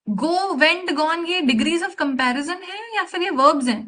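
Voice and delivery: high-pitched, disbelieving teacher's question; pointed, incredulous rebuke; incredulous scolding. Real, not acted. Challenging a student's wrong answer.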